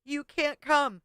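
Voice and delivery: high, slow, firm no; mock firm refusal. Co-host imitates her refusing the ex, high and slow. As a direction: spell it out slowly, high. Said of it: "and you were like".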